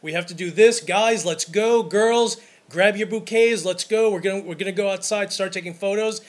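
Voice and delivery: loud, pushy call to get everyone moving; calling the group to move. Retold or imitated. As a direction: raise the voice like rounding up a wedding party. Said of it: "I will voice my opinion".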